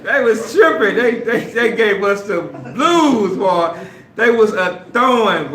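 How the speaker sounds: loud, high, laughing excitement; excited laughing. Real, not acted. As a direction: relive it, half laughing and loud. Laughing, excited retelling of fight.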